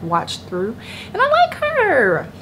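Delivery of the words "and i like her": very high, loud, slow squeal; gushing. Real, not acted. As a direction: squeal it like a delighted fan. Gushing about a preacher she likes.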